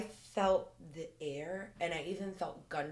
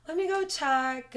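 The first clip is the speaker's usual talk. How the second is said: bold call; 12 semitones higher, 9 dB louder than usual. Retold or imitated. Bravely goes to check noise.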